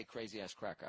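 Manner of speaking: dropping the voice on "crazy ass cracker". Shown as a demonstration. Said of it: "I wouldn't say".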